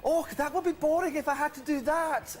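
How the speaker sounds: high-pitched mock Scottish accent. Host imitates the girl from the speaking clock, bad Scottish accent. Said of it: "that was my Scottish accent".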